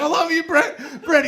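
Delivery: very high, loud cry; shouted love call at high pitch; acted yelled declaration. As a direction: imitate her yelling devotion. Imitated woman yelling her love.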